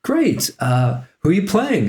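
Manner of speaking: mock delighted Lisa. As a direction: lift it way up, cheerful and eager. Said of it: "And she's like".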